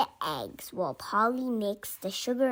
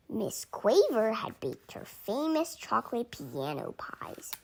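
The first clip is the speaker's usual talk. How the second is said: ordinary delivery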